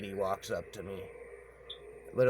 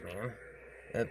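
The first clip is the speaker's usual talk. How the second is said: ordinary talk, nothing marked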